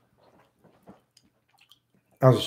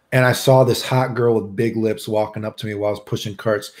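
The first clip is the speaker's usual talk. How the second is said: normal talking voice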